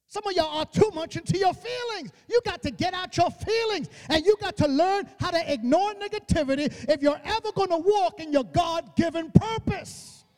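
high-pitched, insistent exhortation; full-force preaching. Real, not acted. Preacher telling congregation to ignore negativity, impassioned preaching. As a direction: drive each phrase home to the congregation.

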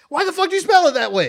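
loud, high, fast outburst; exasperated yell. Retold or imitated. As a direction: yell it in disbelief. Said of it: "I was like".

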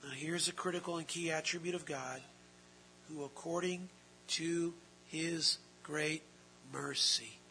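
usual speaking voice

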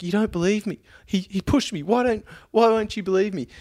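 high-pitched mock pleading; pleading passenger. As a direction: imitate her aggrieved whine, insisting. Imitating the passenger pleading to him.

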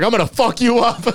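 high, loud mock threat; brother threatening. Big brother jogging after the cyclist, high mock threat. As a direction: shout it after the fleeing biker.

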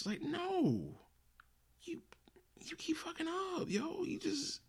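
high, strained falsetto rant; exasperated squeak. Real, not acted. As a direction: voice cracking up high in frustration. Ranting at the studio.

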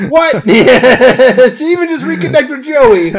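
loud, high and laughing; excited laughing plea. Real, not acted. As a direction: gleefully call out to her. Begging a listener to get in touch.

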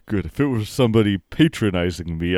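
ordinary delivery

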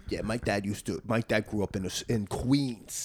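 low, hushed mock tough guy; wannabe mobster kid. Comic mimicking a suburban classmate as a low, soft wannabe mobster. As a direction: play a schoolkid posing as a mobster, softly. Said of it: "a kid who talks like that".

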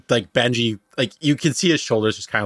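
ordinary delivery

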